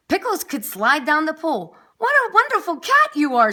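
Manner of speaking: high, loud and delighted; excited praise. Acted. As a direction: cheer the cat on, thrilled. Fireman praises Pickles.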